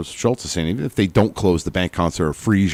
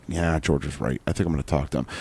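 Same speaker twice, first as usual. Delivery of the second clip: softer, lower, reasonable voice; calmed-down other person; 6 semitones lower, 9 dB quieter than usual. Retold or imitated. The other person agreeing with George, softer and lower. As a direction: play the other guy coming round, quietly. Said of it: "the other person who may have been like".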